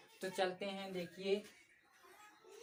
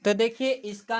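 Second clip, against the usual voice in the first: much louder, calling out to the class; 3 semitones higher, 14 dB louder than usual. Real, not acted. Teacher calling out to the class, much louder. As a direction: grab the room's attention.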